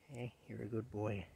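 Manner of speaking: soft affection for a pet, barely voiced; tender to the cat. Real, not acted. Tenderly soothing Rocky the cat.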